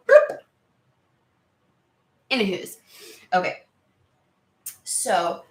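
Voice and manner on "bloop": very high, loud little bloop; imitated leaping bloop. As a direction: pop it out high, like a leap. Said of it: "I just saw it go".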